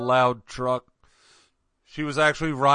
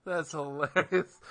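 ordinary talk, nothing marked

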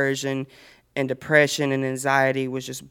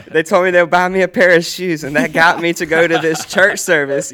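louder and higher, through laughter; amused, laughing; 4 semitones higher, 7 dB louder than usual. Real, not acted. Admitting a funny bribe to the group, laughing. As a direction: tell it loudly through a real laugh.

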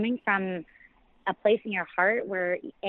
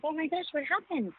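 higher, quieter startled gasp of a question; a mother rushing in to find her son upset; alarmed mom; 8 semitones higher, 5 dB quieter than usual. Retold or imitated. Mother finding her crying son, high and alarmed. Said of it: "And I'm like".

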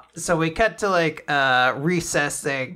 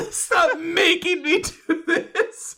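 high, slow laughing whine; laughing whiny plea; 12 semitones higher, 3 dB louder, slower than usual. Retold or imitated. Retelling the PE teacher begging Shen, laughing high. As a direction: beg through giggles.

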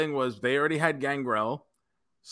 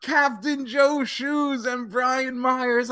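higher, slow drawn-out announcer call; ring announcer; 8 semitones higher, slower than usual. Retold or imitated. Announcing the new tag champions to the crowd, booming and slow. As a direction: stretch it like a big arena announcement. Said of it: "they do the announcement".

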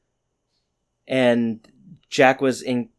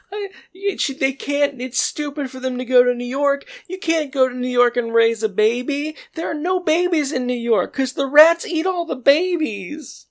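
much higher squeaky imitation of the mother's rant; hysterical mom; 13 semitones higher than usual. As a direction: rant like a shrill, panicked mom. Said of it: "She goes".

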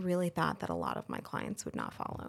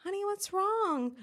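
concerned salon owner; 13 semitones higher than usual. Retold or imitated. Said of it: "and she's like".

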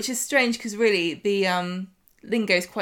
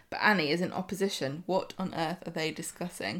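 usual speaking voice

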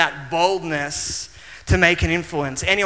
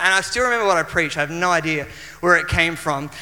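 usual speaking voice

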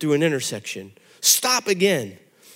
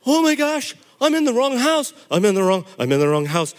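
panicked; 5 semitones higher, 5 dB louder than usual. Retold or imitated. Kid panicking in wrong house.

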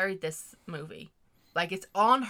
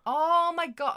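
excited disbelief; 10 semitones higher, 7 dB louder, slower than usual. Real, not acted. Imagining the cut storyline.

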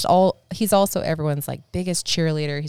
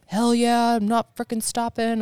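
put-on pumped, higher yell of a teen hiker; excited teen; 7 semitones higher, 3 dB louder than usual. Son answering his mom's order: pumped, higher yell. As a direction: hype it up like a fired-up kid. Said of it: "He's like".

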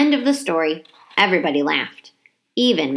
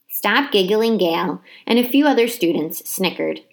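ordinary delivery

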